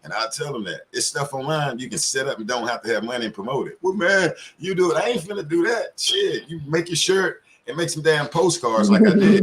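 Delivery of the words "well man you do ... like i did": mock indignant. Mocking people who won't act.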